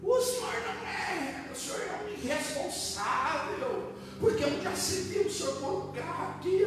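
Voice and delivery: high, slow indignant scolding; outraged neighbour. Retold or imitated. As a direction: scold him like an offended neighbour. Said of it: "Essa mulher... ela ia me bater".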